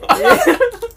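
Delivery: high-pitched burst of laughter. Real, not acted. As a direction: crack up at the joke. Tertawa karena celetukan mabuk. (tawa pecah bernada tinggi)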